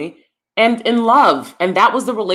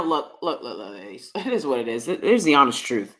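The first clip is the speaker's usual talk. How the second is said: normal talking voice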